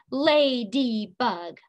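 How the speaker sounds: "Ladybug" slowly, in three beats: la-dy-bug; split into syllables. Shown as a demonstration. Teacher stomping with the class, "Ladybug" syllable by syllable. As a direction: separate each syllable of "Ladybug", one per stomp. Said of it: "You wanna do it again?".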